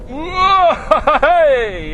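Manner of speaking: high, loud whoop of thrill; excited whoop. Real, not acted. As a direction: whoop as the car takes off. Car surges as he accelerates.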